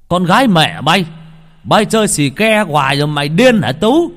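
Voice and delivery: loud, high angry shout; furious cursing. A narrator's reading. Uncle cursing out drug-addled Tú, furious shout. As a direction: curse him out at full voice. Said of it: "liền đứng dậy chửi".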